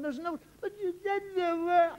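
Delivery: high, slow whimpering protest; tearful child. Retold or imitated. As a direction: whine it through tears.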